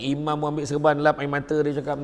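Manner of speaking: ordinary talk, nothing marked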